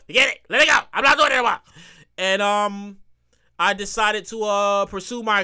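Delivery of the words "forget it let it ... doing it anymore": louder, higher fed-up outburst; fed-up quitting. Retold or imitated. Writer retelling how he gave up after radio rejections, fed up. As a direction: throw in the towel, louder and higher. Acting out his fed-up decision to quit.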